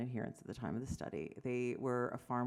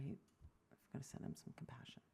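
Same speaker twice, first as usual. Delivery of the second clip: a soft whispered aside; 14 dB quieter than usual. Real, not acted. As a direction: whisper it just for the room. Aside to the audience.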